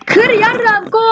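high, frantic yell to break it; urging himself on in a panic, voice jumping up. Real, not acted. Gamer panicking as the monster closes in, frantic high yell.

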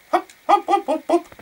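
high-pitched bouncy hops; playful hopping. Real, not acted. Gamer hopping off to the house, playful hopping. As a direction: pip each hop up high.

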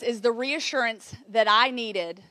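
ordinary talk

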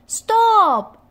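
high, loud cry of stop; sharp loud warning shout. A narrator's reading. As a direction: yell it out to halt them. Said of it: "shouted Comer".

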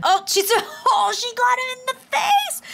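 high, amazed squeal; thrilled, high-pitched play-by-play gasp; excited exclamation. Real, not acted. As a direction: react to a direct hit, voice climbing high. Reacting excitedly to snowball hit.

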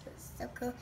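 excited whisper. Real, not acted. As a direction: hush it like a secret. Aside about the pool.

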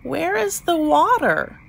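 lightly voiced high little creature; a hint of a small, raspy hatchling. A narrator's reading. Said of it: "in a small, raspy voice".

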